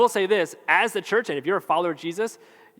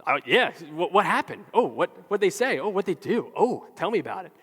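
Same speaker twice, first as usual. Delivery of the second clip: eager gossip-hungry questions; hammed-up excitement with swooping pitch; acted excitement; 3 semitones higher than usual. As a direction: perk up and fish for every detail. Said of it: "we get excited about that".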